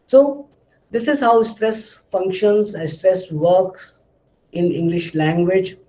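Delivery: usual speaking voice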